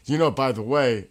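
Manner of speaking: put-on a casual English accent, done lightly. [name] imitates Nick's English accent to listeners, lightly. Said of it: "in his English accent".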